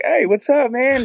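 high, bright call up to the friend; cheery greeting. Retold or imitated. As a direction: wave and call out to the upper deck. Waving to friend upstairs.

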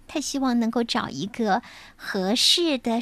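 usual speaking voice

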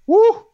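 loud, high exasperated whoop; exasperated woo. Real, not acted. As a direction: let out a frantic whoop. Venting about work, sudden outburst.

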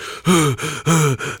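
imitated out-of-breath gasps; huffing. Friend walking in with the jacket, mock huffing. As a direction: heave breaths as if winded. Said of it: "like, huffing".

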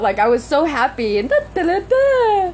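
high, crying voice on da da da; choking up mid-thought; tearful. Real, not acted. Recalling being happy, starts crying.

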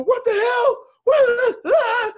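very high, loud panicked shouts; acted shocked screaming. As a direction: scream in horrified disbelief, breaking off into a cry. Reliving discovery of missing foot.

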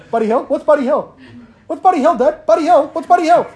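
put-on a delighted little boy repeating the word; child's voice. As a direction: son gleefully quizzing dad about the new word. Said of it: "Ted in the background goes".

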